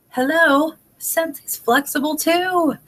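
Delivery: high, slow, knowing "hello"; emphatic "hello". Real, not acted. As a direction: sell the obvious point. Emphatic obvious point.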